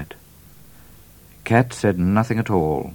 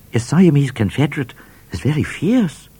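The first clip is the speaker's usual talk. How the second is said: higher, brighter trooper voice; trooper's voice; 9 semitones higher, 4 dB louder than usual. A narrator's reading. Trooper remarking to the bushranger, higher voice. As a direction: play the friendly trooper.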